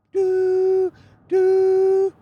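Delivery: high, loud falsetto train whistle; train whistle imitation. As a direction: toot it out like a steam train whistle.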